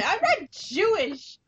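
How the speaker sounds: high, drawn-out protest; indignant protest. Real, not acted. Woman denying a teasing nickname, indignant. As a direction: stretch it out in exasperation.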